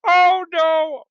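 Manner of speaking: high, drawn-out wail of dismay; hammed-up "oh no"; mock dismay. Comic victim reacting to bad news, mock dismay.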